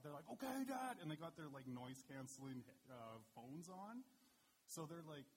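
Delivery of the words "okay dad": put-on high, loud little-boy reply; eager kids' voice. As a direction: chirp it back like an excited young son. Boys answering dad on hunt.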